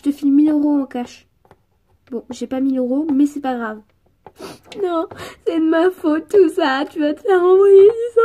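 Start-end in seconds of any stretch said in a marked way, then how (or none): 4.8-8.2 loud, high sobbing blur; garbled tearful wail. Acted. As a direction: wail it until the words drown in sobs. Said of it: "J'ai rien compris alors".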